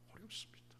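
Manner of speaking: whispered answer. Real, not acted. Whispered repeat.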